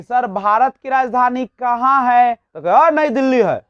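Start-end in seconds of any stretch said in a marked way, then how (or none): 0.0-2.3 student asking. Acted. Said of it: "कहा कि". 2.8-3.6 loud, blunt reply to a pupil; curt offhand answer. Shown as a demonstration. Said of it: "तो क्या ऐसा बोलिएगा तो सही लगेगा?".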